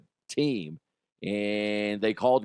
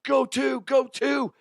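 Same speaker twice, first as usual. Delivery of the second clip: coach yelling; 13 semitones higher than usual. Retold or imitated. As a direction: holler the runner on to second. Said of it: "is just like".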